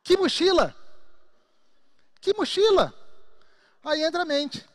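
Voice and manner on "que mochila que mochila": high, loud incredulous "Que mochila?"; hammed-up loud disbelief; mock incredulous. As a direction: act baffled that there's any backpack. Denying the backpack exists.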